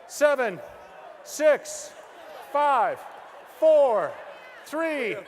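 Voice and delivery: loud countdown shouted to the crowd. Real, not acted. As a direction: count it down loud and fast, like an auctioneer. Counting down audience offer loudly.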